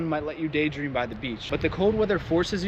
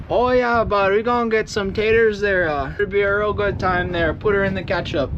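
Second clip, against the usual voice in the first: mock northern accent, loud and higher, jaw barely moving; 5 semitones higher, 3 dB louder than usual. Vlogger mocking how northerners talk with a frozen jaw, loud and high. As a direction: lips stiff like a frozen face, hamming up the North Country talk. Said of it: "your mouth doesn't move all the way".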